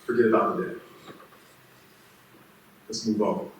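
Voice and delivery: low, soft, unhurried pardon; a king gently forgiving his servant; gentle forgiveness. Acted. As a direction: quietly release him from the debt.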